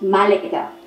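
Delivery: "maleta" stressed on the first syllable, MA; wrong stress, MÁleta. Shown as a demonstration. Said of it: "Porque no decimos maleta".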